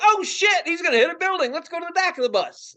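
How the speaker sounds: high, loud pretend panic; mock alarm. Passengers seeing a coming crash, mock panicking. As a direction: cry out in comic alarm.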